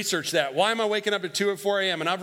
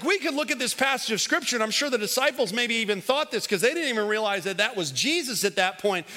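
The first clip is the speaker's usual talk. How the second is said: normal talking voice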